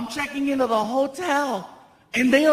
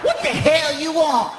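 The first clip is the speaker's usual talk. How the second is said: higher, sharp clerk scolding; annoyed clerk; 4 semitones higher than usual. Retold or imitated. Night clerk scolding the late guest, higher and sharp.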